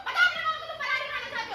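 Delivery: loud, insistent call to the son; loud demand. Acted. Mother loudly pressing her son to speak up. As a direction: press the son hard to answer.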